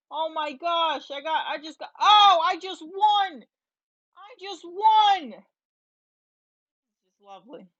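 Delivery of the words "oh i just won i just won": high, slow, loud victory cry; excited. Real, not acted. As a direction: savor the win out loud. Wins the round.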